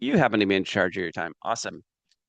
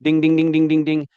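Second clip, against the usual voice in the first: loud, higher mock warning chime; alarm ding; 5 semitones higher, 6 dB louder than usual. Frustration as a car warning light, loud mock chime. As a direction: ring it out like a dashboard alarm. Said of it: "What if frustration was like".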